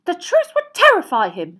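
high, loud, alarmed interruption; shrill wifely protest. A narrator's reading. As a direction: cut in shrilly, very worked up. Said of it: "said Missus Weasley shrilly".

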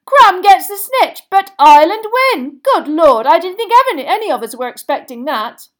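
loud, high announcement easing off at the end; astonished commentator. A narrator's reading. Commentator taken aback by the sudden ending, amazed shouting. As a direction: shout the surprise result to the stadium. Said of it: "shouted Bagman".

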